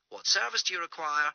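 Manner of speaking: a tinny emergency operator; operator on the phone. Acted. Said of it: "says an operator's voice from Ellie's phone".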